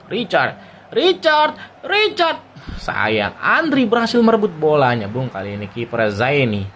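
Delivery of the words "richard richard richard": rising, excited name calls. Real, not acted. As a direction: call the name as the play builds. Excited commentary on an attack.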